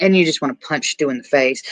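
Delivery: normal talking voice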